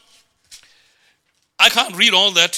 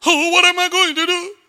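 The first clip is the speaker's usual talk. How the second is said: mock crying and begging; 9 semitones higher than usual. Preacher mimics a believer begging God, mock wailing. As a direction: imitate a pleading cry, pitched way up. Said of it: "You don't have to cry and beg".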